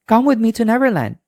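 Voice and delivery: high, fast and eager; eager invitation. Acted. Peter inviting Wendy to fly away, eager. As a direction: sweep her off with excitement. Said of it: "Peter said".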